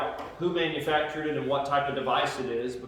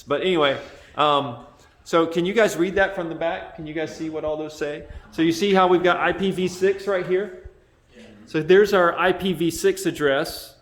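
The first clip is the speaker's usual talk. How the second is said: raised, louder presenting voice; really loud; 3 semitones higher, 8 dB louder than usual. Real, not acted. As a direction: project to the back of the room. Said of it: "I bet my voice is really loud".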